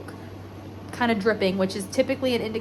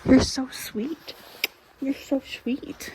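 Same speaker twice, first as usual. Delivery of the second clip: tender; 4 semitones higher, slower than usual. Pretend. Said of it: "She goes".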